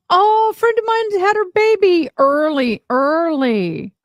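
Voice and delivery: high, excited gasp of news; surprised. Real, not acted. Telling viewers a friend's baby came early, high and surprised.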